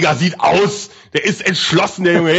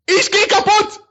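high, slow, ecstatic commentator yell; ecstatic goal call; 10 semitones higher, slower than usual. Real, not acted. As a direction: lose it over the goal.

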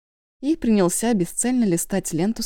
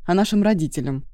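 usual speaking voice

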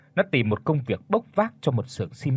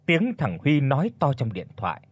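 ordinary delivery

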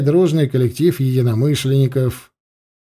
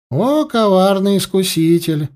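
hammy stage actor; 6 semitones higher than usual. A narrator's reading. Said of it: "театрально воскликнул".

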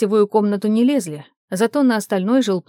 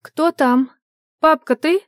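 higher, louder questioning call; raised, expectant voice; 8 semitones higher, 5 dB louder, slower than usual. A narrator's reading. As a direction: call out to whoever just came in. Girl calls out to who came.

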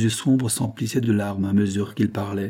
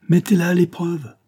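high, loud, fervent urging; impassioned appeal; 8 semitones higher, 5 dB louder than usual. A narrator's reading. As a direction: urge her with heartfelt passion. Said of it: "ces paroles sincèrement émues". (supplication passionnée)

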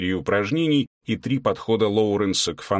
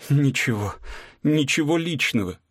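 gurgling through laughter; bubbling words past giggles; 5 semitones higher than usual. A narrator's reading. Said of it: "пробулькала она". (булькая от смеха)

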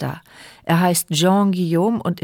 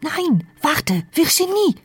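urgent call; 8 semitones higher, slower than usual. Acted. Inner plea as the child runs off.

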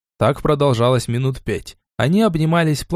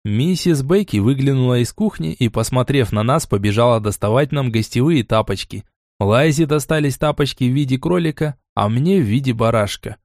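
ordinary delivery, nothing marked

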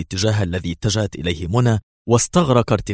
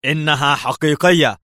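growled, high and loud in another man's manner; posing as Levy, growling an answer to her; Levy's growl; 7 semitones higher, 5 dB louder than usual. Acted. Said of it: "زمجر مستخدما أسلوب ليفي".

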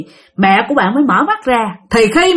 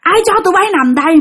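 high, fast, scolding ghost voice; angry ghost yelling; 6 semitones higher than usual. Retold or imitated. Ghost scolding the couple sleeping in his spot, high fast furious yelling. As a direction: rant like an old ghost chasing intruders off his spot. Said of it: "ổng vừa la".

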